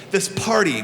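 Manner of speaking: ordinary talk, nothing marked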